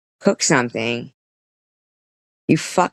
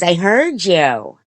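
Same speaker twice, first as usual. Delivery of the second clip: imitated irritated mother; 4 semitones higher, 4 dB louder, slower than usual. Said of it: "she goes".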